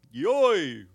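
high yelped yawn sound; a silly high yawn cry; yawn noise. Shown as a demonstration. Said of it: "what I can do is yawn".

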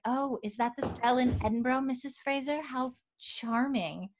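mock a condescending society woman; catty society lady.

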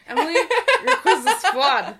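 high, loud, laughing complaint; laughing mock outrage. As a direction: shout it through laughter.

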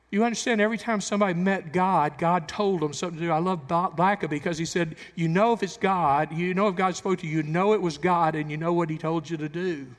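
loud rising preacher's call; preaching at full force. Acted. Preacher urging the church, loud and rising. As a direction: pour it out to the congregation.

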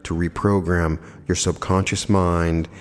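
calm relaxation lead with long pauses; guided meditation. Acted. Host talking listeners into letting go of tension, guided meditation voice. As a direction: guide them gently into rest, unhurried and slightly softened.

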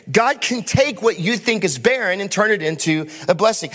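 rising, fervent sermon delivery over the band; impassioned preaching. Acted. Pastor to congregation, fervent preaching. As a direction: preach it out with building conviction.

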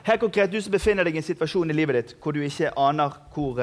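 normal talking voice

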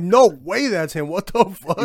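loud, slow stunned reaction; shocked disbelief. Retold or imitated. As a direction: blurt it out in disbelief. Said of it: "I'm like".

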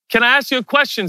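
high-pitched polite call. Acted. Akiva asking the guard atop the gate, high call. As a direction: pitch it high, as if to someone above.

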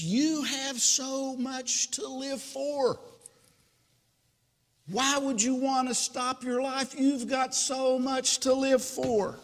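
high, sustained dramatic appeal; earnest pleading. Acted. Man talking a jumper out of suicide, high earnest pleading.

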